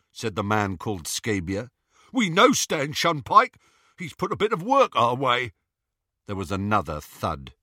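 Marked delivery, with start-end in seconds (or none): 2.1-5.5 high, loud henchman voice; jeering henchman. A narrator's reading. Gang member to lying captive, high jeering. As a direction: sneer it up high, like a cocky crook. Scabior's voice.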